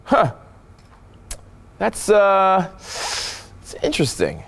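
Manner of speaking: slow, halting, high "that's, uh" then sinking; pondering interest. Shown as a demonstration. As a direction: mull it over with long pauses. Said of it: "it's how I'm saying it".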